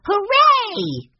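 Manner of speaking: put-on high, loud boyish cheer; excited cry. A narrator's reading. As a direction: cheer like a thrilled little boy. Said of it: "cried Benny".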